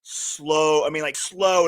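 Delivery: "slow" dragged like a crawling retrieve; drawn-out emphasis. Retold or imitated. Angler showing how slowly he reeled, drawn out. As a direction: stretch each "slow" out long.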